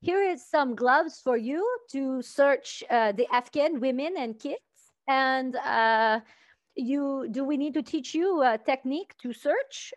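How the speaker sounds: put-on slow, careful French accent; French-Canadian accent. Police handing gloves to a soldier, French-Canadian accent. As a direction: imitate French-speaking police explaining in English. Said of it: "like, French".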